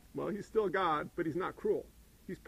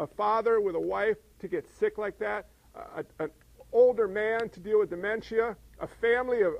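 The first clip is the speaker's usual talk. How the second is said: ordinary talk, nothing marked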